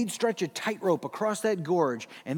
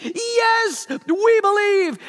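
much higher and louder, slow eager cheer; crowd shouting yes; 14 semitones higher, 10 dB louder, much slower than usual. Retold or imitated. Crowd answering Blondin, cheering shout. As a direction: cheer it back with everything.

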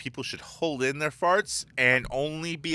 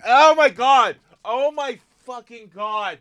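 high, loud start, then slower disbelief; appalled exclamation; 6 semitones higher, 6 dB louder, slower than usual. Real, not acted. Host reacts to a bad smell, appalled and high-pitched. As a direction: recoil from an awful stench.

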